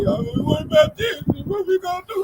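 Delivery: high, panicky imitation; mock scared voice. Mocking panicked middle-aged callers, high scared voice. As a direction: squeak it like a nervous fifty-year-old.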